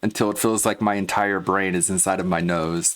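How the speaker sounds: usual speaking voice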